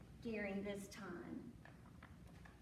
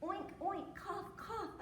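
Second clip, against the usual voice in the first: high-pitched mock oinks; duck oinking; 7 semitones higher, slower than usual. A narrator's reading. Storyteller as the duck who caught the oink, high oinking. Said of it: "said the duck".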